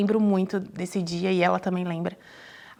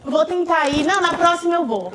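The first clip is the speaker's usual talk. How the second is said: mock excuse; 6 semitones higher, 3 dB louder than usual. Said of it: "E quando a pessoa fala".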